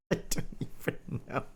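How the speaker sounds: usual speaking voice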